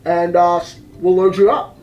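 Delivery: low-pitched pilot announcement with "uh" pauses; unhurried, low PA-style captain voice; airline captain. Retold or imitated. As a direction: imitate a captain on the cabin intercom, voice dropped. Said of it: "captain speaking".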